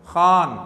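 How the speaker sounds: खान with a throaty kh scraped on the palate. Shown as a demonstration. Teacher showing students the खे sound, खान with a palate kh. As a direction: say खान with kh rubbed at the back of the mouth. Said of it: "तालु से touch होकर आवाज़ निकली".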